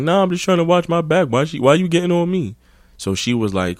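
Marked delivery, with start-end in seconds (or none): 0.0-2.5 high whiny excuse; imitating the dude. Dude complains to the girl, high whine. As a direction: whine like he's hard done by. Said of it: "He's like".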